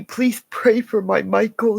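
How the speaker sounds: crying, high and loud, begging for prayers; tearful plea. Real, not acted. Pleading with viewers to pray for Michael, tearful. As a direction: plead through real tears. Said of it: "I apologize for crying".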